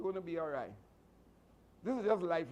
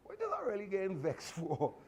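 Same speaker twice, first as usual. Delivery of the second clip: self-talk with a laugh; 4 semitones higher than usual. Retold or imitated. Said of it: "you're telling yourself".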